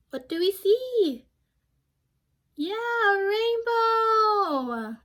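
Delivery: high, loud and drawn-out delighted cry; excited. Real, not acted. Teacher revealing the rainbow to the class, excited. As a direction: make the big reveal sound thrilling.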